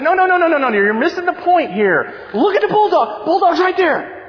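re-enacted high-pitched, frantic insistence. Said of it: "he's even more excited now".

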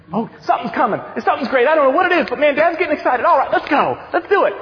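imitating a child's mounting excitement, pitched high; excited little daughter. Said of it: "And Hope kinda was like".

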